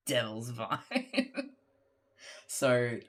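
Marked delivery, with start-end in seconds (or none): 0.1-1.3 put-on Devil's Vine in a German accent, slow, V for W. Streamer reading chat's request, German accent. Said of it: "in German accent".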